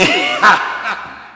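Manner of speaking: high, loud evil cackle; monster's cackle. Acted. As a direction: cackle in triumph like a beast. Monster laughs after the threat.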